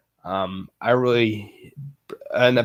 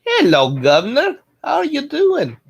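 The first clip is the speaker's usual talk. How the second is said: high, rising Cockney greeting; hammed-up Cockney accent, pitched high; 14 semitones higher, 4 dB louder than usual. Shown as a demonstration. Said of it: "Maybe Cockney".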